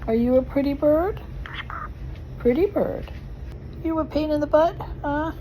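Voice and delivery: teaching a parrot. Real, not acted. Talking to the pet bird.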